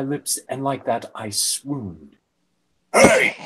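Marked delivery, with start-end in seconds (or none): 2.9-3.3 very high, loud mock yell; shout. Metal Man shouting across the room, hammed up. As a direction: bark it out to stop him. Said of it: "I heard Metal Man shout".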